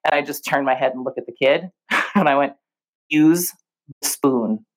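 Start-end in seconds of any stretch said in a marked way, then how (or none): 3.1-4.7 put-on calm stern teacher telling a toddler at Disney World. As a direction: drop into stern preschool-teacher authority. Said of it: "the face and the voice".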